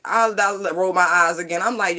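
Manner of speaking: ordinary talk, nothing marked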